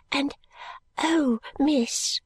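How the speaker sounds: put-on high, half-whispered child; little maid overcome with happy tears; tearful little girl. A narrator's reading. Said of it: "with the tears starting down her dimpled cheeks".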